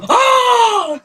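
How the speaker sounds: high loud startled cry; startled yell. Retold or imitated. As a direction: yelp as if grabbed. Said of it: "And they would go".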